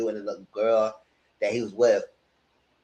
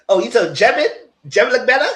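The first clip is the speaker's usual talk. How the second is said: surprised; 9 semitones higher, 8 dB louder than usual. Real, not acted. Mock surprise at a claim.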